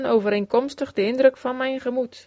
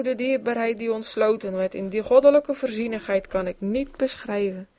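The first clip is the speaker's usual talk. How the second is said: ordinary delivery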